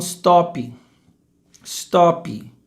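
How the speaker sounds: "stop" with an i on the end, "stoppy"; Brazilian added vowel. Shown as a demonstration. Teacher quoting what people often say, "stop" as "stoppy". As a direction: add a small "ee" after the p of "stop". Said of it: "Repare que tem um i".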